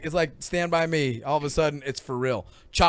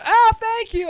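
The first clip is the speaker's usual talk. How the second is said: grateful strangers; 10 dB louder, slower than usual. Retold or imitated. Strangers thanking wrong guide.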